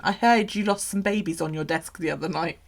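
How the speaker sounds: ordinary talk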